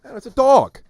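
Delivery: loud, high-pitched exclamation; mock outburst. Host answers co-host about the dog, high mock outburst.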